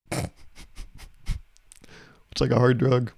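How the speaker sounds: ordinary talk, nothing marked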